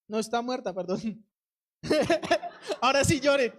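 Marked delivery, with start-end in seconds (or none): 1.8-3.6 real laughter around the words; laughing. Real, not acted. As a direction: joke through a burst of laughter. Laughs at own slip. (entre risas, con voz fuerte)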